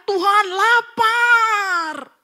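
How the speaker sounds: loud, high cry to God, long drawn out; belted, slow, high-pitched plea; retold hungry shout. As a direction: stretch it into a wailing yell to heaven. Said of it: "Saya selalu teriak".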